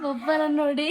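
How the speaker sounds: loud, laughing outburst; sudden laughter. Real, not acted. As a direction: burst out laughing while speaking. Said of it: "ಇವಾಗ sudden ಆಗಿ ನಗ್ತಿದ್ರಿ". (ಜೋರಾಗಿ ನಗುತ್ತಾ)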